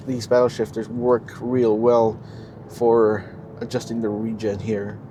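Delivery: normal talking voice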